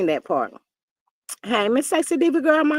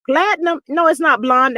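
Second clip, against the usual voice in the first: ordinary talk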